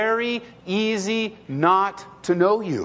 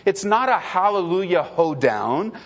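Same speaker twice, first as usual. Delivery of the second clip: ordinary talk, nothing marked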